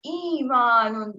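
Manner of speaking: ایمان ان very slow, the ای held long; correct long vowel. Shown as a demonstration. Teacher models the full ای of ایمان ان for the student, slowly. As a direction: stretch the ای of ایمان fully. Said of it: "short پڑھ لیتے ہیں".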